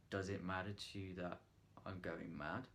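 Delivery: soft, low, questioning; quiet questioning. Shown as a demonstration. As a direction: ask it gently under the breath. Demo take, soft questioning.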